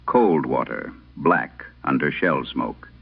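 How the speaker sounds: ordinary talk, nothing marked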